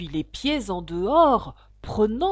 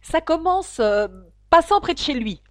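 high, loud prompt; feed her the opening line; a lightly voiced griffon prompting; 8 semitones higher, 8 dB louder than usual.

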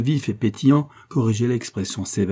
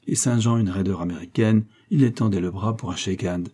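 ordinary delivery

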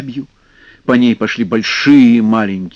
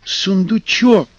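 urgent plea; 7 semitones higher than usual. Acted. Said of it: "хором ответили".

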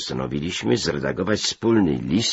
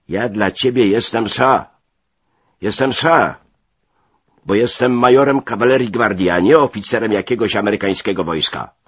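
loud, raised, proud protest; indignant assertion; 5 semitones higher, 7 dB louder than usual. A narrator's reading. Cavalry major demands to be called Sir, indignant. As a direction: insist angrily on due respect. Officer angrily demands respect.

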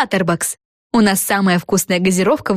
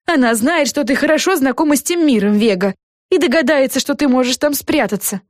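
mildly flustered, raised voice; higher-pitched voice with a touch of worry; worried café owner; 7 semitones higher than usual. A narrator's reading. Café owner warning Vega she will be found, mildly worried higher voice.

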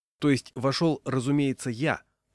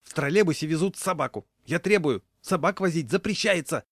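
high, loud, agitated demand; agitated complaint; 6 semitones higher, 4 dB louder than usual. A narrator's reading. Raincoat man reporting a dog to the conductor, agitated. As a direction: complain in a mounting fluster. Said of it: "продолжал волноваться человек".

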